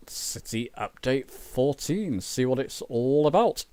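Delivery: ordinary delivery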